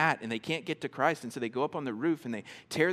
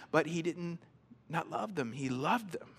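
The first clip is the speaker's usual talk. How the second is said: usual speaking voice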